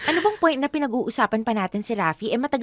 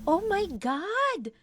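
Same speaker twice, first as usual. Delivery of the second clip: surprised gasp; 8 semitones higher, 8 dB quieter, much slower than usual. Acted. Friends gush over ex's photo.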